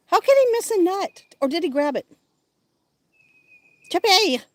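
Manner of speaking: high, loud cheering of a pet's name; excited calling. Real, not acted. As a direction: urge him on to grab the nut. Cheering squirrel on from window.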